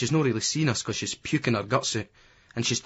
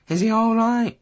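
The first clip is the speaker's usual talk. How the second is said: high, loud North of England call; North of England accent; 11 semitones higher, 5 dB louder than usual. A narrator's reading. Lassie following an injured boy, North of England accent. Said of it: "in a North of England accent".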